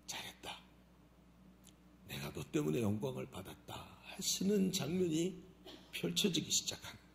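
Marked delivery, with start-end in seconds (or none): 0.1-3.7 soft, hushed praise; tender whisper. Acted. God quietly praises the obedient believer. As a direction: whisper it gently like a blessing.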